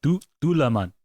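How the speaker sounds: Dulaman built up from a repeated soft "du". Shown as a demonstration. Correcting a friend's attempt, Dulaman with soft D. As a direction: stutter out the first syllable, then say Dulaman whole. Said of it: "it's like a soft D sound".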